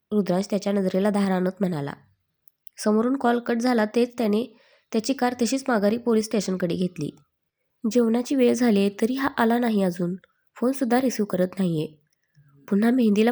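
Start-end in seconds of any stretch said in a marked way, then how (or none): none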